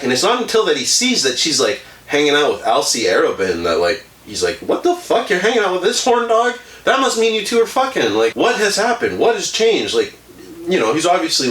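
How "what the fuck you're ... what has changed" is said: high-pitched mock indignation; miffed jealous lover. Robert finds Edna hanging out with a rival, miffed. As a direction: act out incredulous questioning, voice pitched way up. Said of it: "a little miffed".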